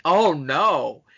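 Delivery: ordinary talk, nothing marked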